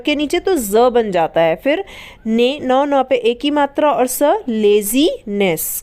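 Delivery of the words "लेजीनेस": लेजीनेस said very slowly, syllable by syllable; slow pronunciation. Shown as a demonstration. Teacher modelling laziness slowly for pupils. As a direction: stretch out लेजीनेस le-zi-ness slowly. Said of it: "क्या पढ़ेंगे?".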